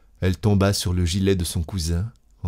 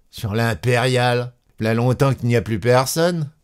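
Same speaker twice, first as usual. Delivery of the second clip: raised, dismissive conductor voice; brusque conductor; 5 semitones higher than usual. A narrator's reading. Conductor brushing off a lost wife, dismissive. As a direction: brush her off, pitched up.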